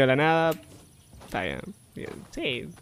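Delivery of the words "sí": normal talking voice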